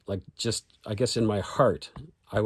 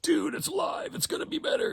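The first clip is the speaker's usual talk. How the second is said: much higher mocking dude voice; hammed-up buddy insisting; dude friend voice. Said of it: "Why would I wanna go".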